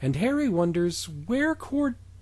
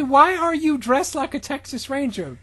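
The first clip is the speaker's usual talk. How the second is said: much higher baffled question; high Ron; 10 semitones higher than usual. Acted. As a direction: pitch it up in confusion.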